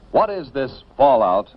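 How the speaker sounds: ordinary talk, nothing marked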